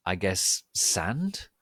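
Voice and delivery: ordinary delivery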